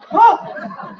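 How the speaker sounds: very high, sharp bursting cry; one fierce uninhibited yell; militant bisexual moan. Acted. Performer demonstrating the uninhibited militant bisexual moan. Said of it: "uninhibited militant bisexual moan".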